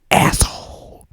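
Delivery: a short whispered curse; whispered insult. Pretend. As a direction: drop the word in a whisper. Insulting the rival wrestler.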